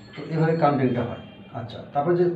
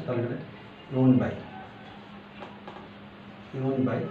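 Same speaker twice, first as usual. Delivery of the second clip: ordinary talk, nothing marked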